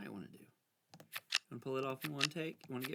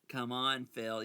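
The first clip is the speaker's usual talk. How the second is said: usual speaking voice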